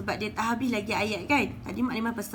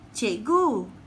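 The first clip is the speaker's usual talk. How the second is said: a pupil's louder call to the teacher; 4 dB louder than usual. Acted. Pupil Bazli calls out to his teacher.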